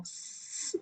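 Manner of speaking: "s" as a pure hiss with no vibration; voiceless hiss. Shown as a demonstration. Teacher shows no throat vibration, long hissed "s". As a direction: draw out "s" without any voice. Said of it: "no hay vibración".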